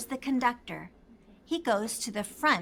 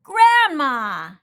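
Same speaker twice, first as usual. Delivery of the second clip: happy call; 2 semitones higher, 10 dB louder than usual. A narrator's reading. Pete sees Grandma.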